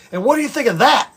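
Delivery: imitating an irate customer, high and loud; retold angry yell. Irate customer ending his rant at the caller, retold angry yell. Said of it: "I screamed and said".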